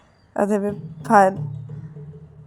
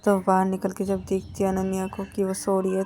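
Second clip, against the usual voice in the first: ordinary delivery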